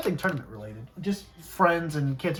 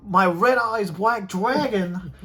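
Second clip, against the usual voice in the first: imitating a thick Boston accent; loud, slow Boston accent; 5 semitones higher, 6 dB louder, much slower than usual. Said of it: "thick Boston accent".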